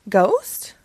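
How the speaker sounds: lively read-aloud storytelling voice; story narration. A narrator's reading. Storyteller reading a Halloween book to children.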